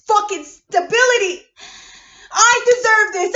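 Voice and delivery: real furious yell; betrayed rage. Real, not acted. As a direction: spit it out at the people who left you. Raging demand for respect.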